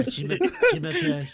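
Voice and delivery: very high mock French, through laughter; squeaky, stumbling French attempt; French accent. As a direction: giggle out a French introduction. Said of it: "say that in a French accent".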